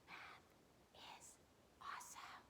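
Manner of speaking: spaced-out whispered class chant. Shown as a demonstration. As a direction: whisper it softly with a pause after each word. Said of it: "Let's whisper it today".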